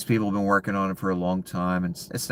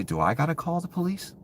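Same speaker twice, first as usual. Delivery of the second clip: mock innocent question; 12 semitones higher than usual. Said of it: "I say".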